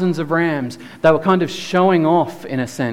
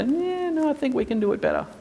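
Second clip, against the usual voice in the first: mocking dismissive people; 3 semitones higher, 4 dB quieter, faster than usual. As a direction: toss it off like a smug know-it-all. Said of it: "throw it back in his face and say".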